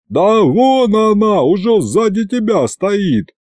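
high, drawn-out answering call; crowd calling back. A narrator's reading. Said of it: "откликнулась площадь". (протяжный отклик толпы)

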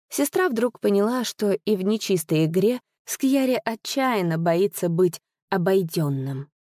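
usual speaking voice

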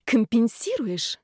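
plain reading voice

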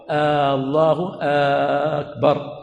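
"الله" and "أكبر" each begun with a long drawn-out "آ"; slow, stretched first sound on "الله", then on "أكبر"; lengthened hamza. Shown as a demonstration. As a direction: hold the opening vowel of "الله" and of "أكبر". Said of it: "يعني مد همزة".

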